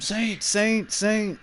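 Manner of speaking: high-pitched mock calling out; yelling for someone. Ass Face calling for Saint from the car, mock yelling. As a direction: imitate someone calling for help, voice jumping up. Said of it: "like, yelling for him".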